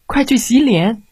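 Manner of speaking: lightly voiced bossy mother; nagging mother. A narrator's reading. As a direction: hurry the child along like a nagging mother. Mother orders child to wash.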